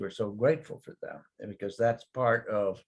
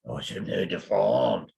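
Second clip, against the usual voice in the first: slow, louder resigned French phrase; French reply; 5 dB louder than usual. Retold or imitated. Quoting the French answer to comment ça va, slow and resigned. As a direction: shrug it off in French. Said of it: "so they say".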